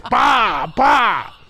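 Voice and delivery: loud, high smack noises; explosive mimed slaps; imitated smacks. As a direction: punch out each smack sound. Imitating smacks in fight story.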